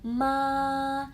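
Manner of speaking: MA held like a choir note; "Ma" long, straight and high; level first tone. Shown as a demonstration. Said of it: "seperti paduan suara".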